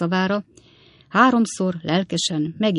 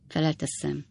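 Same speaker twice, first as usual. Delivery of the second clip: usual speaking voice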